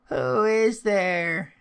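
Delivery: high, loud, drawn-out call; old grandmother calling. A narrator's reading. Grandmother calling to someone at the door, high and drawn out. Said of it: "said some voice in the house".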